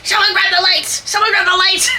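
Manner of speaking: high, strained mock yell; frustrated mom. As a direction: imitate an exasperated mother calling for help. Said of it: "my mom is frustrated".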